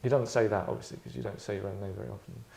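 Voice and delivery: usual speaking voice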